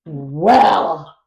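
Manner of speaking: slow, loud, snooty imitation of the rude guest; snotty indignant mock. Mocking the rude guest at book club, loud and snooty. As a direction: drag it out with haughty offense.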